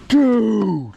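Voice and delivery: stunned high dude. Real, not acted. Stunned by the catch.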